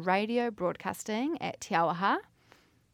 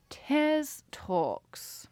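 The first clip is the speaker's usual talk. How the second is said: Tiz Talks sung out higher with a long falling Tiz; odd show-name read; 6 semitones higher than usual. Shown as a demonstration. Host announcing her podcast name, then laughing it off, higher drawn-out announcer tone. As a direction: stretch "Tiz" up high and let it fall slowly. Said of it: "I don't know why I said it like that".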